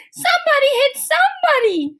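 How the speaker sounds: high, loud excited yell; shout. A narrator's reading. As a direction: egg them on at the top of your voice. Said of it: "shouted Lori".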